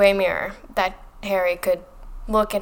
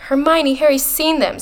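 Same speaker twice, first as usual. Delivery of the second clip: high, louder burst of protest; rounding on her; 7 semitones higher, 5 dB louder than usual. A narrator's reading. Ron defending Harry to Hermione, rounding on her. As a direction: turn on her with a mildly heated objection. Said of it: "rounding on her".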